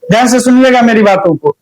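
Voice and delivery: ordinary talk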